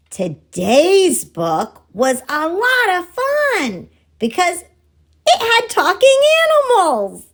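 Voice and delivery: put-on high, bouncy puppet voice greeting the kids; a playful monkey puppet, cheerful and excited; cheery puppet voice. Puppet greeting the daycare and Messy Church kids, high and cheery.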